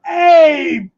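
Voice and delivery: loud high call across a room; shouting. Pretend. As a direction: call out to a friend far away. Calling out across con floor.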